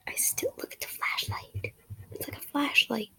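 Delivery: soft muttered aside; whispered aside. Real, not acted. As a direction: whisper to yourself.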